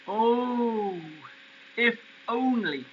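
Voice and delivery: high, drawn-out wistful sigh of longing; wistful wishing. A narrator's reading. Mister Hoppy daydreaming to himself of being a hero, wistful. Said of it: "he kept telling himself".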